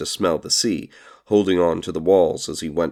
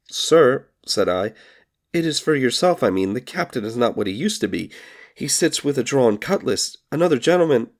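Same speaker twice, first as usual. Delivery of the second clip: put-on frightened boy; 7 semitones higher than usual. Frightened boy pleading with beggar.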